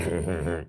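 short hearty brother's laugh. A narrator's reading. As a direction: laugh it off smugly. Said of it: "und Berthold lachte".

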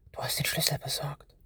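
soft, breathy whisper. A narrator's reading. Boy hiding from danger, whispering his thanks. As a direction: whisper for fear of being heard. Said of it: "flüsterte er nur".

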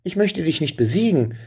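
put-on high, urgent boy's cry; hasty call. Boy hastily cries out high to the dragon. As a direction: blurt it out fast to stop the fire. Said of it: "als Benjamin hastig rief".

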